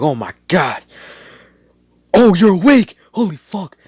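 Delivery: a startled nurse squealing in shock; startled shriek of surprise; freaked out. Retold or imitated. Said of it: "she freaked the fuck out".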